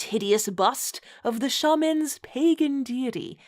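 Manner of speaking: usual speaking voice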